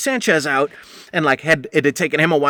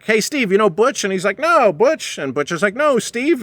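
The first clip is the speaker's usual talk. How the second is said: mock introduction; 7 semitones higher than usual. Awkward introduction, voices acted.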